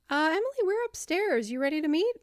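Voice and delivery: high, polite questioning voice; phone caller. Acted. Colleague phoning Emily about the meeting, expectant caller. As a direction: lift the voice like a chirpy caller.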